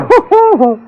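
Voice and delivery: short high laugh, tossed off; a light mocking laugh at the diary idea; amused laugh. Woman amused by his request.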